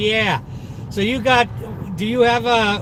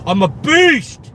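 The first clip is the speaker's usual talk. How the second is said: loud, high, drawn-out boast; mock-macho roar; 5 semitones higher, 6 dB louder, slower than usual. Driver bragging about carrying bags one-armed, mock-macho roar. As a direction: bellow it like a playful strongman. Playful boast repeated louder.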